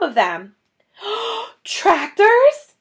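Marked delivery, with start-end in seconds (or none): 1.0-1.6 shocked gasp. Acted. Foxes see tractors. 1.6-2.7 high, loud alarmed cry; shocked shout. A narrator's reading. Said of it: "shouted Mister Fox".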